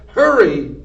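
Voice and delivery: loud, barked hurry; Cook shouting. A narrator's reading. Cook urging Mig to hurry, loud bark. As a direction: snap it out loud. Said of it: "shouted Cook".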